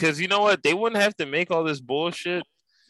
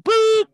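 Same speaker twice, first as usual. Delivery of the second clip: squeaky high boop, louder; tiny squeak of a boop, much higher; silly high boop; 6 dB louder than usual. Acted. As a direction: pop out a cartoon boop. Comic sound word.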